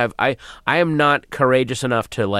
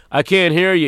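louder, high mock complaint; heckler calling out; 8 semitones higher, 5 dB louder than usual. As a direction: call out like an audience member. Audience calling to quiet comic.

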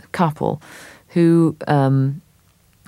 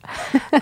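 tinkly fake laugh; 11 semitones higher than usual. Marjorie's laugh.

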